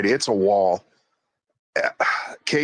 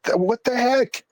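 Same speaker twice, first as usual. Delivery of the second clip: sharply higher, baffled outburst; baffled what the heck; 12 semitones higher than usual. Retold or imitated. Reacting to dead goggles, sharply higher and baffled. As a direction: blurt it out in disbelief.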